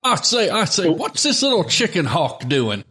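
high, swooping Southern cartoon rooster; blustering rooster impression, drawing words out; Foghorn Leghorn. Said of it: "Loved Foghorn Leghorn".

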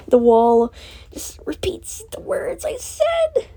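slow, breathy half-laugh through the words; laughing whisper. Real, not acted. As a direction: laugh it out under your breath. Bitter joke about talking to wall.

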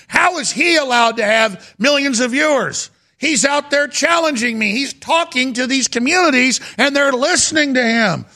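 high, mock-indignant complaint; mocking John Oliver. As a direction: play up a rival's outrage. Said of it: "he's saying".

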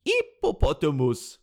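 French-accented attempt at American English; slow, careful French-accented mispronunciation. Host mimics a French girl's clip, French accent. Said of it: "French girl trying to do an American accent".

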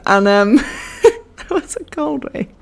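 fighting off the giggles; helpless laughter on the line; giggling. Real, not acted. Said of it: "Fucking hell, pull yourself together".

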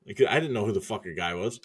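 ordinary talk

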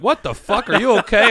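a cabbie suddenly worried about his passenger; mock-alarmed driver, voice pushed up high; alarmed taxi driver. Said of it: "basically, he's like".

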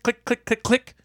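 high, soft rapid clicking; imitated breaker clicks. Electrician hears breakers being flipped, high imitated clicks. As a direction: mimic breakers snapping with small high clicks. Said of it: "I start hearing click".

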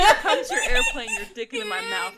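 very high, laughing singsong; airplane spoon game. Pretend. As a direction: squeal it like baby feeding, cracking up. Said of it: "airplane spoon game".

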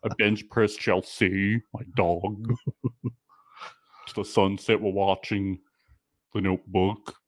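low, dopey bro impression; mock dude voice. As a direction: drone it like a dull dating bro. Imitating the guy's bio.

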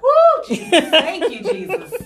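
high, loud joyful cry to Jesus; praise break. Retold or imitated. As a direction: shout thanks to heaven like in church. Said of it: "she just gave us a praise break".

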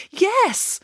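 high, keen yes; eager yes. Acted. Nanny Piggins eagerly agreeing with Michael, high yes. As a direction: jump on the idea.

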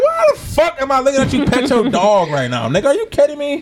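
disbelieving rant at a friend; mock outrage. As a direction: act baffled and fed up, voice up high. Acting out own outraged reaction.

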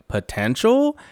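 high, disbelieving question; incredulous. Retold or imitated. Garcelle questioning Erica, high incredulous echo. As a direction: echo the word back in disbelief.

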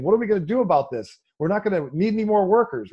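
high, quick imitation of alarm; mock alarm. Presidential candidate reacting to automation, mock alarm.

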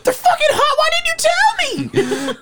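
very high tearful whine; hammed-up wailing complaint. Drunk brother burned by pizza rolls, mock crying wail.

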